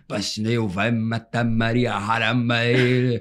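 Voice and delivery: slow, lightly put-on Arabic accent. Recreating his audition line for the hosts in a slow Arabic accent. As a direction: drag the words out in a mock Arabic-accented Portuguese. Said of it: "eu fiz um sotaque".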